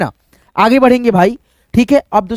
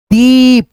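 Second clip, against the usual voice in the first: दीप with the ई held long, louder and higher; long stressed ee; 3 semitones higher, 5 dB louder, much slower than usual. Shown as a demonstration. Teacher showing students the long ई in दीप. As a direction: lean hard on the ई of दीप. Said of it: "ई पे जोड़ लग रहा है".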